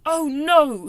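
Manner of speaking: high, very loud cry of alarm; dismayed cry. A narrator's reading. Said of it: "he cried".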